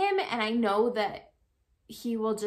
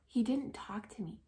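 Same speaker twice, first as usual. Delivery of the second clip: a real whisper, no voice at all; whispered aside; 9 dB quieter than usual. Real, not acted. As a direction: drop to a breathy whisper as if confiding. Whispered aside about his silence.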